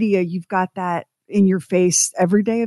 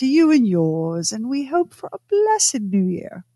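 put-on Mid-Atlantic accent; 5 semitones higher than usual. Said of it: "That Mid-Atlantic theater accent".

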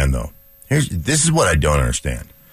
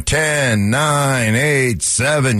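lightly imitated fans' countdown, higher and drawn out; crowd countdown; 6 semitones higher, much slower than usual. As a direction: chant the numbers slowly like a cheering crowd. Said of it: "would have been cheering".

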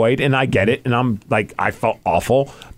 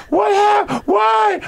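over-dramatic woman; much slower than usual. Retold or imitated. Imitating the frantic woman, very high squeal. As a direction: squeal it like a panicked lady. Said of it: "she's like".

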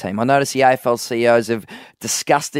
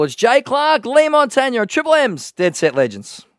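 radio ident; 11 semitones higher than usual. Acted. Radio station ident sung/announced.

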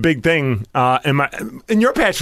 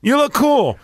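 mock quote; 10 semitones higher, slower than usual.

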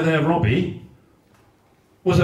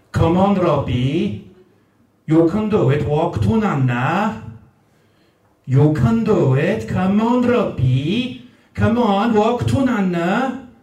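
lightly done South Wales Newport granny voice, coaxing; a hint of a Newport nan coaxing a baby to walk; Newport accent; 3 semitones lower than usual. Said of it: "I'm gonna do the accent".

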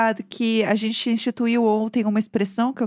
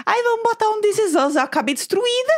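exasperated; 8 semitones higher than usual. Real, not acted. Complaining about a sad episode.

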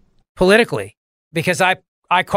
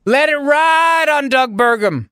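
retold shout; 10 semitones higher, slower than usual. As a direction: bellow it like a gambler all in. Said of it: "I said".

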